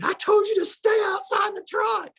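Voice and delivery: squealed mock yell, pitched very high. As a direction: imitate an exasperated friend squealing a scolding. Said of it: "he used to squeal and yell at me".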